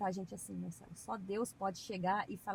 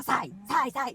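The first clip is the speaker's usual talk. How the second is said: rude dog command; 4 semitones higher, 7 dB louder, much slower than usual. Shown as a demonstration. The rude way she doesn't use.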